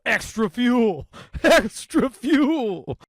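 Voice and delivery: high, drawn-out mock bellow; mock war cry. Gut-slapping man taunting gym kids, drawn-out mock yell. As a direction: stretch it out in a loud, high holler.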